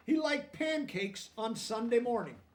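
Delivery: lively storybook reading aloud; reading to children. A narrator's reading. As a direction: read it out to the class, bright and clear.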